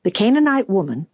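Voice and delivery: usual speaking voice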